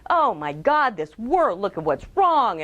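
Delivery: hammed-up loud dismay; mock outrage at the state of things; alarmed complaint. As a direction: lament it with heavy, punched stresses. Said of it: "rather than".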